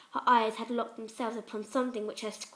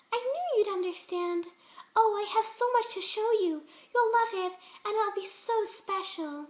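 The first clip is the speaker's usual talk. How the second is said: high, bright and louder, gushing with joy; beaming happily; 10 semitones higher, 3 dB louder than usual. A narrator's reading. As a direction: beam with eerie delight. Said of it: "Fluttershy beamed happily".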